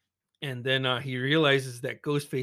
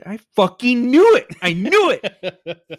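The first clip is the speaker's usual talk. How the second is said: triumphant yell; 8 semitones higher, 13 dB louder than usual. Real, not acted. Killer reveal, outburst at screen.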